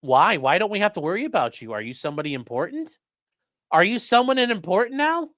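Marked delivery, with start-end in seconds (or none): 3.7-5.3 mocking taunt. Mocking a streamer's self-importance.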